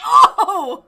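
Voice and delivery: high, very loud whooped "oh"; loud reaction. Retold or imitated. Game master reacting to a high roll, huge laughing whoop. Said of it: "I was like".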